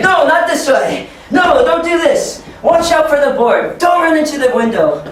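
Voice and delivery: high, loud calling out; head them off with high, loud warnings; urgent warning. Pretend. Teacher stopping kids running into the wall, urgent high call.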